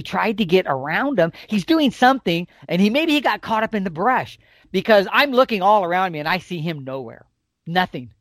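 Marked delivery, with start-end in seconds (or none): none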